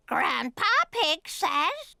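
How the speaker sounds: talking-bird voice; parrot mimicry. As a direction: repeat it by rote like a parrot. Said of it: "I can teach Polly what to say".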